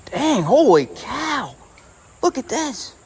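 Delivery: high, slow, loud amazed shout; bursting with surprise at the catch; excited exclaim. Real, not acted. Big fish on the line.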